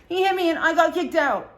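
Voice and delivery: put-on loud, high whiny baby voice; baby talk. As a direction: imitate a drunk friend talking like a baby. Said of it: "she's talking like a baby".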